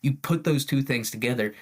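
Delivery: ordinary delivery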